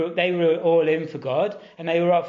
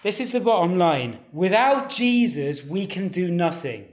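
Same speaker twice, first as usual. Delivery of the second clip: ordinary delivery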